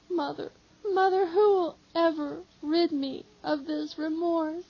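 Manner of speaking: high, wailing cries of remorse; weeping disciple begging the mother, wailing; anguished crying out. Acted. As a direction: cry out in despair to the mother. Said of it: "And he shouts".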